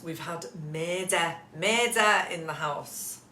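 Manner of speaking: slow, lightly put-on Scouse accent with murder said as "medder". Vlogger teasing viewers about a house drama, playful Scouse accent. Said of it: "shouldn't say it with a Scouse accent".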